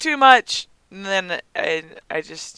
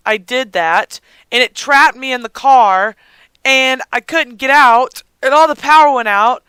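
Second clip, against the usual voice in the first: ordinary delivery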